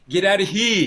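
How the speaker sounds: loud, high English accent attempting mobster talk; proper Englishman badly faking a wise guy accent; English accent fumbling wise guy. Co-host imitating the English actor's botched mobster line, English accent. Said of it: "But with his English accent".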